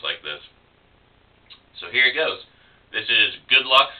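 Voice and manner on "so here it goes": ordinary talk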